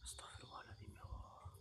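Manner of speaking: frightened whispered prayer. Real, not acted. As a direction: breathe the prayer in fear. Frightened prayer at haunted spot.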